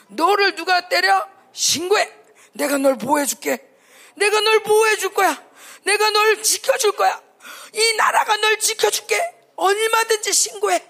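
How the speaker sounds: loud, high, sugary mock promise; preacher mocking the world's coaxing of kids, loud and high; mock sweet promise. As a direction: parody a world that coaxes children.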